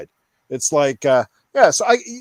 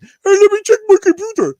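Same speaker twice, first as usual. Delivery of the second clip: very high, loud dopey clerk voice; mocking a clerk; 8 dB louder than usual. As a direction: ham up the clueless employee stalling. Mocking clueless store clerk.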